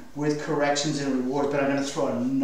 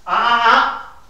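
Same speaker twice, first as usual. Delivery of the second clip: sharp correction; 7 semitones higher, 8 dB louder than usual. Shown as a demonstration. Trainer correcting the dog.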